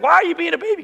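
high, loud exasperated dad; retold loud scolding. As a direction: imitate a father telling off his kid. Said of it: "my dad would get mad at me".

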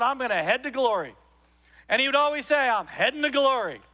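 loud, high, driving pulpit delivery; full-force preaching. Acted. Preacher telling the congregation about his dad in a loud, high sermon voice. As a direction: preach it out to the whole room.

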